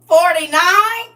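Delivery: slow, high and loud; stretched-out shocked question; outraged disbelief. Pretend. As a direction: drag it out, horrified. Incredulous repeat of the age.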